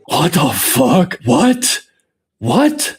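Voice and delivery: a slow, loud, baffled what the fuck; stunned disbelief. Real, not acted. Streamer watching a strange clip, loud and baffled. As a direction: react to a video that makes no sense.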